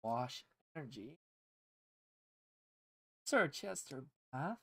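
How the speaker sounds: soft, slow seductive murmur; sultry voice. Pretend. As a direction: seduce the cards. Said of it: "Give us a sultry voice for this pack".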